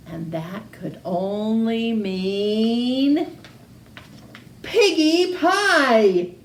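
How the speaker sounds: slow the build, then land piggy pie; storyteller savoring the big reveal; slow dramatic reveal. A narrator's reading.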